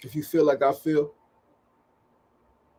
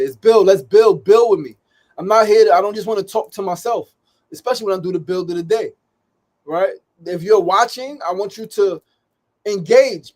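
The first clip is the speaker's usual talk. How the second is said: higher, louder impassioned urging; impassioned plea; 6 semitones higher, 8 dB louder than usual. Real, not acted. Host begging his live viewers to engage, impassioned and loud. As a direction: rise up and beg them to engage.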